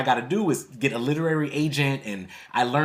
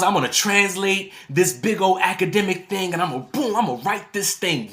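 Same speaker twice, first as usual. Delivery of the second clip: excited; 5 semitones higher, 3 dB louder than usual. Retold or imitated. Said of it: "I get so excited about this".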